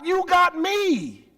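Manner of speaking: high, slow tender "got"; tender repeat. Acted. Father to son, repeating tenderly. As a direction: repeat it lovingly.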